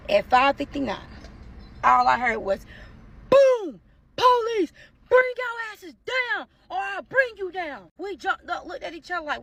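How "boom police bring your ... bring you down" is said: high, loud imitated police command, words drawn out; police raid shout. Police ordering residents to come down, shouted.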